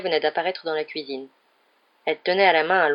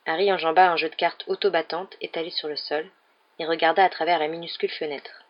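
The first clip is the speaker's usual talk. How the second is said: ordinary delivery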